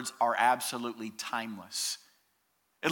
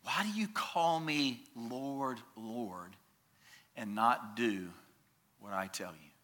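quiet reread; 3 semitones lower, 7 dB quieter than usual. Shown as a demonstration. Preacher rereading Jesus' question to the church, hushed. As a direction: let the words sink in softly. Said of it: "When I hear those words".

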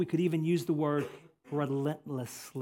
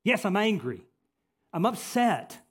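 loud, defiant insistence; forceful acted retort to God; emphatic, angry Jonah; 5 dB louder, slower than usual. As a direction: hit each phrase hard, angrily. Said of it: "this is very emphatic the way it's written".

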